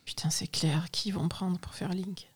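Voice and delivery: whispering. Real, not acted. Whispered aside on podcast.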